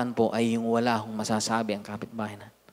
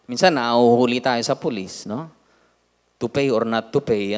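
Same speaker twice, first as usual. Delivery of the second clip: usual speaking voice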